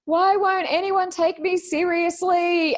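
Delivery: high, slow whiny plea; mock whining. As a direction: plead in a high, slow whine. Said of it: "Beth wrote on there going".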